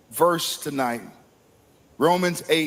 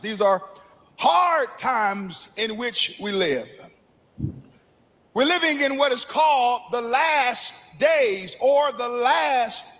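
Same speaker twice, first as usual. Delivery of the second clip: high, ringing sermon delivery; full-force preaching; 6 semitones higher than usual. Acted. Preacher warning the congregation of dangerous times, ringing. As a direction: preach the last days with fire.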